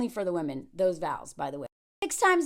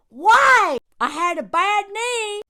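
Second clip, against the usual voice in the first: loud, high hammed-up complaint; drawn-out theatrical howl; mock anguished wail; 8 semitones higher, 9 dB louder, slower than usual. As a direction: wail it in fake despair. Mock wail quoting his excuse.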